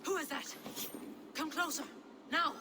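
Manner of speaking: scared shout. Acted. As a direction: demand it in panic, ready to fight. Frightened woman confronts intruder.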